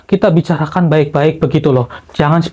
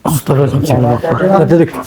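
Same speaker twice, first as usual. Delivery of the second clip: ordinary talk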